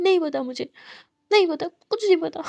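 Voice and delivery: put-on an upset girlfriend refusing; sulky shout. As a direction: snap it back stubbornly. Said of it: "उसका मतलब".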